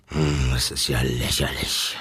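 put-on grumbling old wolf. A narrator's reading. Wolf scolding the zookeeper over slippers, deep growl. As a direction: mutter it deep like an offended wolf. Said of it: "schimpfte der Graue".

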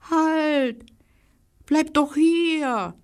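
high, slow pleading squirrel voice. Acted. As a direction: call out like a small squirrel. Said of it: "die Stimme seines Freundes".

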